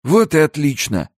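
put-on high-pitched satisfied old man; pleased old trader. Old man satisfied once safely on horse, high and pleased.

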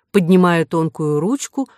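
usual speaking voice

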